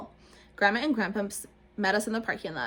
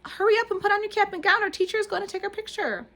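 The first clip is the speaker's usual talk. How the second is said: put-on urgent little girl; 12 semitones higher, 6 dB louder than usual. A narrator's reading. Lucille urging Junie to get dressed, high and loud. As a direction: hurry her along, stretching the words. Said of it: "said Lucille".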